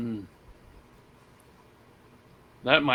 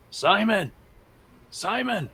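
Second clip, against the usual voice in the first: higher-pitched call over the comms; 7 semitones higher, slower than usual. Acted. Player calls teammate Simon on comms, calling out. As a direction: call out the name, voice rising then falling.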